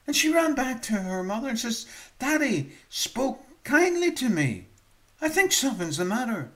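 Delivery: high, loud, quick storytelling build; preacher retelling a child's amazement; excited preaching. Acted. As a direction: rush it with wonder. Preaching at full force.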